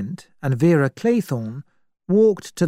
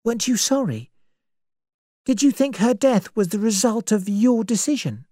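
put-on lightly pitched-up young woman; questioning young woman; 7 semitones higher than usual. Vera questions Emily, a touch pitched up. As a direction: press her gently with the question.

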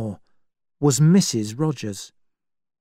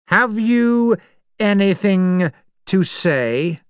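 raised, judge-like voice reading the charges; cold voice from a gramophone naming the guilty; accusing voice; 7 semitones higher, 3 dB louder than usual. Acted. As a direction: pronounce each crime like a sentence from the bench. Accusing voice from the record.

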